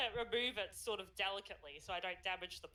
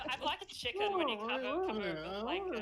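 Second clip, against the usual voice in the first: muffled under a blanket; 2 semitones higher, 5 dB louder than usual. Pretend. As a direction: voice muffled by cloth. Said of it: "you sound muffled because it's over your face".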